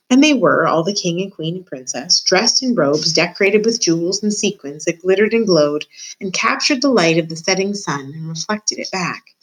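ordinary delivery

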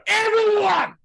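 very high, loud drawn-out shout; yell. Pretend. Yelling out the window as promised, shouted. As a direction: holler it out the window. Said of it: "then I'll yell out the window".

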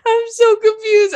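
high, laughing exasperation; still laughing. Real, not acted. Host still giggling in confusion, high. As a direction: let the laugh linger in it.

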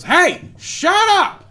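high, slow yelled "shut up"; high mock shout. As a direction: shriek it like an unhinged stranger. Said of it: "just goes".

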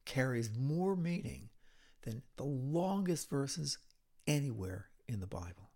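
usual speaking voice

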